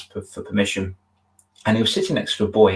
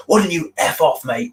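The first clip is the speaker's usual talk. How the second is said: mock aggressive retort; 8 semitones higher than usual. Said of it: "react to it and say".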